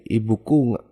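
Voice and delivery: ordinary delivery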